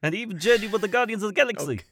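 high, quick storyteller in character; Korg. Acted. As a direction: tell the legend in a high rock-creature voice. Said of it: "I wanna interview Korg so bad".

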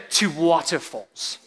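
stately mock-British delivery; British accent. Shown as a demonstration. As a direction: put on a drawn-out British accent with dropped R. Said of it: "in your best British accent".

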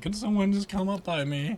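high, pleading helpless man; whiny passenger voice. Retold or imitated. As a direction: beg for help like a whiner. Imitating the passenger's plea.

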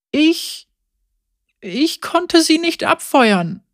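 very high, slow stammer; girl admitting failure to Lucian; shaken girl. A narrator's reading. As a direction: she stutters, still shaken. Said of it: "sagte das Mädchen".

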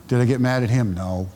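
usual speaking voice

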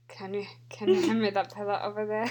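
New Zealand accent attempt. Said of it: "Is it New Zealand? / Yeah".

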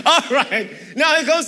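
laughing. Real, not acted. Laughing after joke about long sermon.

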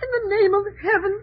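pleading through tears, voice cracking; crying. Acted. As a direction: beg for an answer while sobbing. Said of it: "stop crying".

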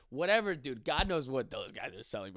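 ordinary talk

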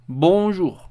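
"Bonjour" en francés, con la o nasal y la r final apenas audible. Shown as a demonstration. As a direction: decir "Bonjour" claro y pausado, como modelo para repetir. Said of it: "lo primero que debemos decir es bonjour". (pronunciación modelo de "Bonjour" en francés)

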